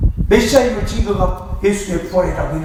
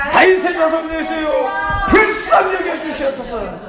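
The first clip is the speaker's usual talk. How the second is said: earnest plea; 6 semitones higher than usual. Shown as a demonstration. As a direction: beg with all your heart, voice raised. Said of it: "간절하게".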